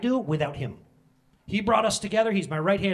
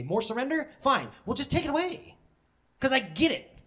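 high, insistent protest to God; exasperated complaint; 7 semitones higher, 5 dB louder than usual. Retold or imitated. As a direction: act out arguing with God, voice pushed up. Acts out own complaint to God.

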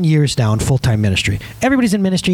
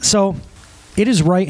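usual speaking voice

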